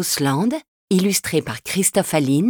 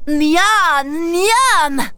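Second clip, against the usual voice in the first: higher, greedy little ogre yum-yum; delighted ogre; 9 semitones higher than usual. Acted. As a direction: savour it like a hungry ogre child. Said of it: "crie Bouli-Bouffe-Tout ravi". (petit ogre gourmand et ravi)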